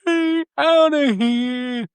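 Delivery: very high, drawn-out mock plea; imitated plea. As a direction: stretch it into a high, comic cry for rescue. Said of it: "He's like".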